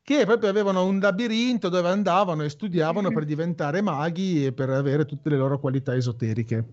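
ordinary delivery

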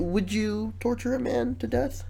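ordinary talk, nothing marked

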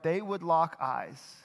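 ordinary talk

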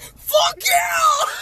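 very high, loud squealed cheer; mock ecstatic shout. Teasing friend's outburst at "assemble", imitated ecstatic shout. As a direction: shriek it like an overexcited fan.

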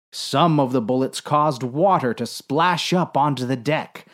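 ordinary delivery, nothing marked

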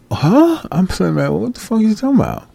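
high incredulous disbelief, fast at the end; incredulous huh. Retold or imitated. Mocking a lazy question with high disbelief. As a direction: throw it back like it makes no sense. Exasperated retort to lazy askers.

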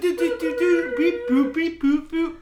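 high beeping robot; toy robot voice. Pretend. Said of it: "Hello, this is a robot talking".

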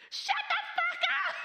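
put-on high, loud squeal of a three-year-old cursing, laughing through it; screeching toddler. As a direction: shriek it like a tiny furious kid. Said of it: "the kid's just like".

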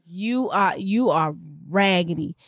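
slow, low, deliberate reproach; retold scolding. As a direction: lay down the verdict word by word.

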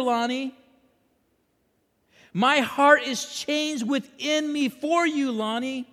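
high, loud sermon plea; impassioned preaching. Acted. Preacher voicing God refusing to give up on him, impassioned plea. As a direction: preach it out, pleading by name.

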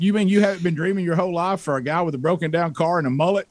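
fast, laughing self-mockery; laughing through it. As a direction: chuckle through the punchline. Joking at himself while retelling.

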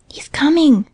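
high, excited half-whisper; breathless excited cry. A narrator's reading. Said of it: "breathing quickly".

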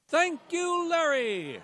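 bright, loud anchor hand-off; cheery loud sign-on. Acted. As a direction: belt the thanks like a TV host.

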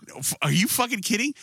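high-pitched scoffing disbelief; mock-outraged disbelief; retold incredulity. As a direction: act out an incredulous reply. Retelling incredulous reply to wife.

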